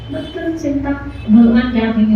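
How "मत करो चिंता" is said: soft, slightly raised soothing voice; consoling. Acted. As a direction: gently comfort a repentant devotee. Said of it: "ढांढस बधाई". (कोमल स्वर में ढांढस)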